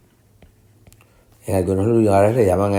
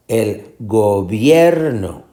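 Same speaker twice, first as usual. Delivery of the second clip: slow gobierno with BIER stretched and raised; stress on middle syllable; slower than usual. Shown as a demonstration. Teacher shows tonic syllable, go-BIER-no stressed in the middle. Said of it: "Esta es la sílaba tónica".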